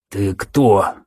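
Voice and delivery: wheezy wounded rasp; hoarse rasp. Acted. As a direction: rasp it out low. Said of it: "прохрипел он".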